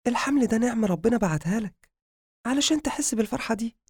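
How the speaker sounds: high-pitched wife voice, encouraging; wife character. Acted. Wife telling her husband to enjoy the pregnancy, high encouraging voice.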